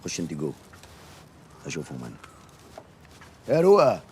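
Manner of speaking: normal talking voice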